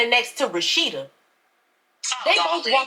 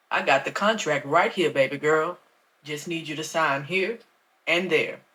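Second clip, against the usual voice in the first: lower, softer, oily preacher voice; smooth pastor; 7 semitones lower, 4 dB quieter than usual. A narrator's reading. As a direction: coax her to sign, quietly. Pastor hands over contract.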